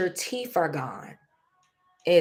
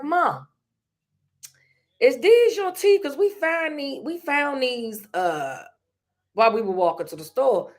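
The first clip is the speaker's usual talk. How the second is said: put-on excited kid; 6 semitones higher than usual. Kids ask their mom about found teeth, high and eager. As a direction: bring it home like a wide-eyed child.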